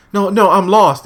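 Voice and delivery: high mock distressed woman. Lost woman refusing the cult member, high mock distress. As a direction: plead it up high like a lost stranger.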